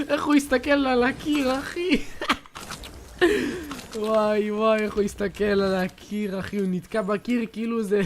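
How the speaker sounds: high-pitched, loud, cracking up at the opponent; laughing mockery. Real, not acted. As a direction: laugh him off for staring at the wall. Mocking opponent staring at wall. (צוחק בקול גבוה ולועג ליריב)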